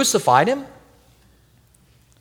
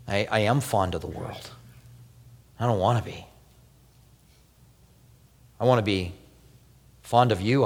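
quiet prayer; 7 semitones lower, 5 dB quieter than usual. Pretend. Voiced prayer of confession.

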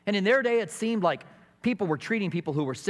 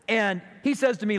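normal talking voice

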